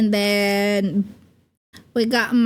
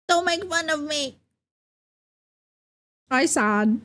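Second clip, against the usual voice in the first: pouty pretend sadness, softer; playful sulky baby voice; mock sad; 4 semitones higher, 4 dB quieter than usual. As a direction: whimper it like a sulking kid. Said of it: "and then we have sad".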